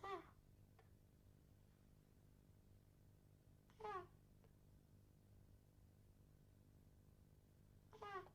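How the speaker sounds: very high, soft "Cat" from behind the wall; thin, high creature copying a word; high-pitched voice. Acted. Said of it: "in a high-pitched voice".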